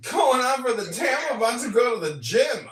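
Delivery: high, loud, hearty mock voice; mock gym bro. Answering his friend with a loud mock brag.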